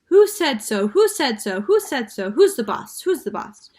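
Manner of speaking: high, loud squawk; parrot yelling. A narrator's reading. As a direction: screech it defiantly. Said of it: "Penelope yelled".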